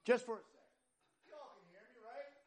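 unamplified voice projecting to the room; high, distant-sounding, off-mic. Shown as a demonstration. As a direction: call out without the microphone. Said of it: "turn the mic off for a little bit".

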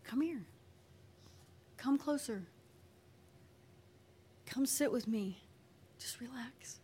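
soft invitation, fading to a whisper; gently coaxing. Acted. God inviting a child to sit with him, gently coaxing. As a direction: coax softly, with long pauses. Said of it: "And God is like".

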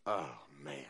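low, soft grumble of a reluctant Naaman; lightly voiced Naaman. Naaman at the riverbank, mildly dismayed. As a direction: sigh it out like a man who'd rather not.

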